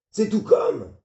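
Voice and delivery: high shouted comeback; furious retort. Acted. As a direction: spit the answer back at him. Father retorting in rage.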